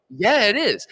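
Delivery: emphatic comeback in a retold dispute; insistent retort. Retold argument, insisting back.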